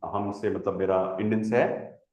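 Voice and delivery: ordinary talk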